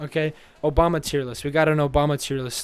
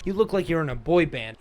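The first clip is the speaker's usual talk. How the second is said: usual speaking voice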